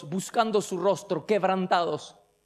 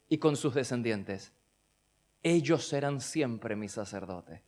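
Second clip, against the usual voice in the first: soft, lower, intimate sermon voice; hushed preaching; 7 semitones lower, 6 dB quieter than usual. Acted. Preacher speaking tenderly to his congregation, soft and low. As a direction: draw them in close, almost confiding.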